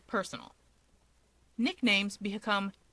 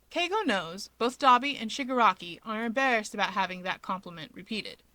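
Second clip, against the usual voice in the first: plain reading voice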